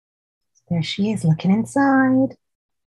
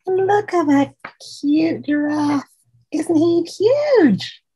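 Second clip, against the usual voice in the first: high, delighted gasp over the giraffe; gushing wonder; 9 semitones higher than usual. Acted. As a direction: marvel at it like a child would. Excited about the giraffe picture.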